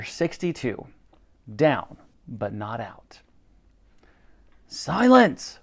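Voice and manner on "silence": high, sharp command; ordering. A narrator's reading. The Admiral quiets the cheering crew, commanding. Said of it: "ordered the Admiral".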